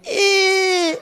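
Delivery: very high strained squeak; squeezed squeal. Retold or imitated. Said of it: "he'd make the same sound".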